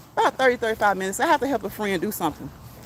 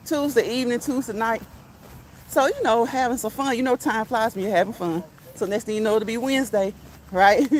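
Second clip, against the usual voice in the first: ordinary talk, nothing marked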